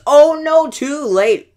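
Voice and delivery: sudden high, loud cry of dismay; a real gamer's loud groan of alarm; dismayed shout. Real, not acted. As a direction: yelp as the tower falls. Opponent's push destroys his tower.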